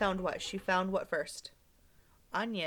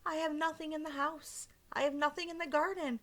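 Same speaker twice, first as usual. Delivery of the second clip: put-on high, timid little old lady; a fretful old woman's voice, raised high; little old lady turning a stranger away; 7 semitones higher than usual. Little old lady refuses young man.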